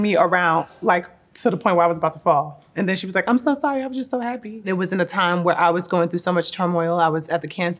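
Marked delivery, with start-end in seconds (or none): 3.3-4.6 higher-pitched imitation of an apologetic mom; high, lightly acted maternal apology; imitated mom apologizing. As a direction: voice a happy mom's gushing apology, pitched up. Voicing mom's excited apology.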